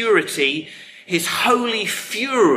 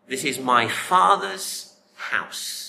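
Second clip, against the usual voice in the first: slow and weighty; slower than usual. Acted. Slow weighty repeat of Jesus.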